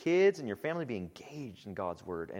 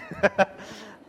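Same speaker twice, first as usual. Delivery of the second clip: breathy laugh; 10 semitones higher, 6 dB louder than usual. Real, not acted. Laughs at son's reaction.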